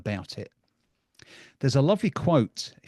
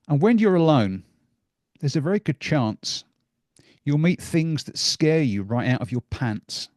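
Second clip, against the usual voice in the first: much louder, booming storybook voice; dramatic reading; 2 semitones higher, 6 dB louder than usual. Acted. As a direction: read it big, like a spooky tale to children. Reads scary Dr Seuss passage.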